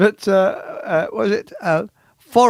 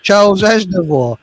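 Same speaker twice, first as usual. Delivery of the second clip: raised, loud announcer-style call; imitated show announcement; 4 semitones higher, 6 dB louder than usual. Introducing a singer in a retold show, mock announcement. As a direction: announce the star's name, higher and louder. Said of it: "he came on his show and he went".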